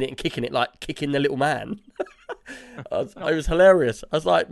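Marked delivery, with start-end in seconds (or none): 2.3-2.9 high laugh. Real, not acted. Laughing at cat story.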